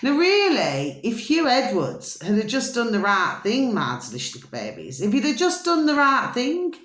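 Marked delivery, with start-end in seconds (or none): 3.7-5.0 rushed, low and soft endearment; a fast murmured aside to the audience; quick low aside. Real, not acted. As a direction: toss the pet name off under your breath. Pet-name aside to viewers.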